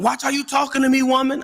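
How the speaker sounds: a man whose patience has run out. Retold or imitated. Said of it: "when they tone go up".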